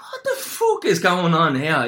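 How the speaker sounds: high, drawn-out disbelieving exclamation; startled outrage. Retold or imitated. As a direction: act out being rudely interrupted, pitch up and slow. Startled by banging on toilet door.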